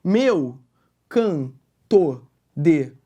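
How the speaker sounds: "Meu canto de morte" very slowly, each syllable apart; "Meu canto de morte" dictated syllable by syllable; syllable-by-syllable scansion. Shown as a demonstration. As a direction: chop "Meu canto de morte" into separate drawn-out beats. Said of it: "parei no mor".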